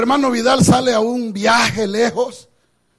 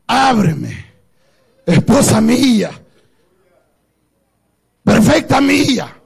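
lower, gentle, lingering plea; with great kindness; 4 semitones lower than usual. Retold or imitated. The Lord lovingly asks his church to open. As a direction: speak it like a lover at the door. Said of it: "Con una gran amabilidad le dice".

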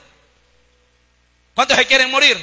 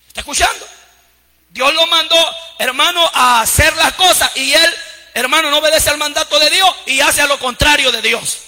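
loud, rising sermon cries to the congregation; full-force preaching; 5 semitones higher, 4 dB louder than usual. Acted. Pastor preaching to his flock, loud and fervent. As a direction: preach it hard, voice ringing out.

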